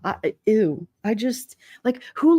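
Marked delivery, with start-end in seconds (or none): none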